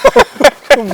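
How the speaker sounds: laugh. Real, not acted. Joking about restriction.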